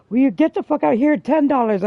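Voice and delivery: quoting the angry friend, loud and fast; angry friend yelling. Retold or imitated. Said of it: "She's like".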